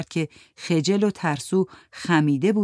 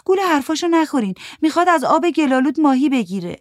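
higher, louder warning voice; Mandana warning; 10 semitones higher, 5 dB louder than usual. Acted. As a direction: warn the others off him. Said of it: "ماندانا سر جنباند و گفت". (هشدار ماندانا با صدای بالاتر و بلندتر)

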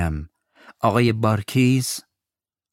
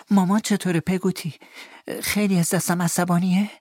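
put-on child's anxious question; 10 semitones higher than usual. Boy asks about mother.

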